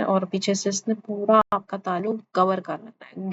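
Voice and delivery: usual speaking voice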